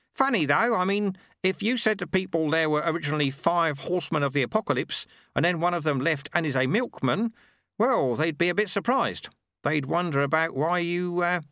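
put-on a genial old man making small talk; Lutze, amused, over a cup of tea; old monk Lutze. Lutze musing to Ronnie.

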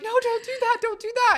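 high-pitched pretend panic; mock alarmed pleading. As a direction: plead high to stop a friend. Acts friend pleading to stop.

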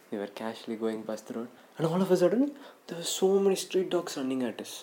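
ordinary delivery

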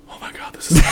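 thrilled hushed whisper; excited whisper. Retold or imitated. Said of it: "I would have been like".

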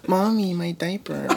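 put-on slow whiny baby talk; playing a fussy baby. Baby voice bit.

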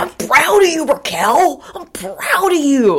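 put-on high, loud, drawn-out drunken declaration; mock drunk speech. Cohost mimics his speech to Raquel, mock drunk and loud. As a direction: imitate a drunk gushing with pride. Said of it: "got drunk and did a speech about how proud he was".

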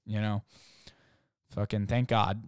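ordinary talk, nothing marked

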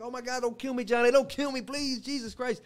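high panicked pleading; begging for my life. Retold or imitated. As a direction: beg desperately, pitch way up. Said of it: "So I'm begging for my life".

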